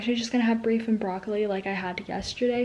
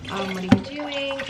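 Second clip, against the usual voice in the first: bright phone greeting; 6 semitones higher, slower than usual. Retold or imitated. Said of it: "And I'm like".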